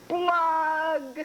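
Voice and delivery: a wet, high pop of bursting gum; bubble pop noise. A narrator's reading. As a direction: spit out the burst like a sound effect.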